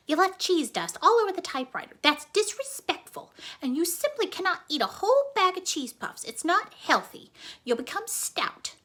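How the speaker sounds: scolding mother. Acted. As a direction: reel off complaints.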